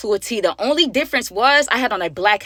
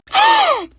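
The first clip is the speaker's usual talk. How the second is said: sharp, very high, loud gasp; a high startled gasp, far louder; shocked gasp; 6 dB louder than usual. Acted. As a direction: suck in air in mock shock. Shocked at being recognised.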